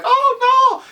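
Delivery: high, loud mock shriek of "Oh no!"; retold high scream. Watching the king choke, high mock shriek. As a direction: shriek it like you're panicking. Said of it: "Not like a woman scream".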